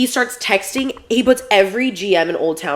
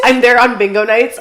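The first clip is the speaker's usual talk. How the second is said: laughing through the words, a bit louder; 4 semitones higher, 7 dB louder than usual. Real, not acted. To friends, joking about haunting the club, laughing. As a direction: crack up while picturing it.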